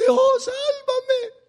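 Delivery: a high, slow shouted plea; desperate cry for help. Acted. Jacob begging God to save him, high slow shout. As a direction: cry out to be saved, dragging the words.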